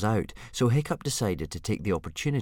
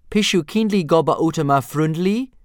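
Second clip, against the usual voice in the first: much higher, louder dragon-tongue plea; spoken Dragonese; 9 semitones higher, 8 dB louder than usual. Acted. Book reader demonstrating a Dragonese plea, high and loud. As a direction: squeal the odd dragon question. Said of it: "punctuated by shrill shrieks and popping noises".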